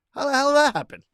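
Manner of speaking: high, loud burst of bewilderment; baffled disbelief. Real, not acted. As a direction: blurt it out in real astonishment. Baffled by a strange post on account.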